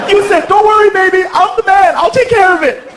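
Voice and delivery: high, indignant mock female voice; scolding mother. Girlfriend quotes stepdad's promise, scolding high voice. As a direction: throw his words back at him.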